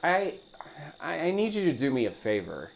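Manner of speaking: usual speaking voice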